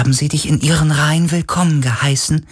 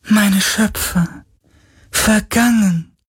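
hohe, langsame Geisterklage; klagend; slower than usual. A narrator's reading. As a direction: wehklagend langgezogen. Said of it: "klagte der Geist". (klagende Geisterstimme)

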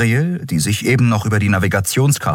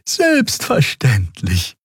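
very slow, higher-pitched friendly assurance; friendly Xea; 8 semitones higher, much slower than usual. A narrator's reading. Character assuring the guardswoman, slow and friendly. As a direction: draw it out obligingly, high and friendly. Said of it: "versicherte Xea freundlich".

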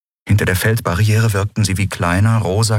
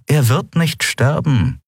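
indifferent; 9 semitones higher, slower than usual. Acted. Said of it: "sagte Monaro gleichgültig".

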